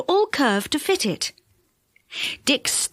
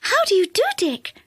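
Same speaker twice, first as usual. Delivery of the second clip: dainty elf greeting; 9 semitones higher than usual. A narrator's reading. Elf Silky greeting politely.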